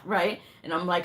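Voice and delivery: ordinary talk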